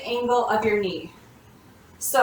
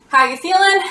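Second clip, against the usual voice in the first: high bright coach question; upbeat check-in; 6 semitones higher, 7 dB louder than usual. Real, not acted. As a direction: cheer them with the question.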